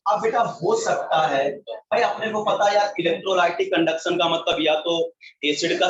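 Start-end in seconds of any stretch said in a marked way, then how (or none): none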